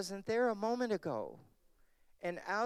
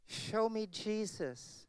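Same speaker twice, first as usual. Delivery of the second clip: high and slow, every word weighted; slow pointed demand; 5 semitones higher, slower than usual. Acted. Preacher challenging doctrine debaters, slow and pointed. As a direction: press "Show me Jesus" home.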